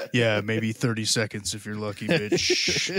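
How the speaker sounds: low mock-seductive tease; a hint of seductive teasing; sultry voice. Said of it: "a sexual voice".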